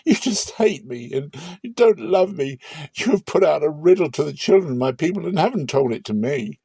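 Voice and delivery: a mild hint of tears as she accuses; tearful pleading. Samson's wife weeping at him for hiding the riddle, mildly tearful. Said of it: "Samson's wife wept before him and said".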